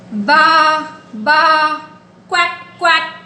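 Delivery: playful animal imitations; sheep then duck sounds. Shown as a demonstration. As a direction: call out the animal noises.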